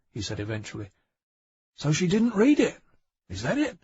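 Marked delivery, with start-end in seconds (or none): 1.8-3.8 dawning surprise. Acted. Bang realising, surprised question.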